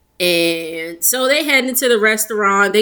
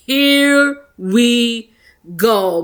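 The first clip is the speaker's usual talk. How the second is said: laughing; much slower than usual. Retold or imitated. Repeats with laugh, drawn out.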